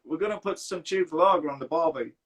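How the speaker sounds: put-on Aussie barbie talk; hammed-up Australian accent. Shown as a demonstration. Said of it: "Oh, you're good at it".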